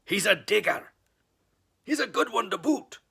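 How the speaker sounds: loud, high pirate shout; pirate yell. Acted. Pirate yells to his crew about the boy, loud shout. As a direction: bellow it back to the crew. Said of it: "yelled back to his crew".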